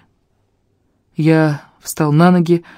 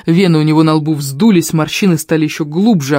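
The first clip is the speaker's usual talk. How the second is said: plain reading voice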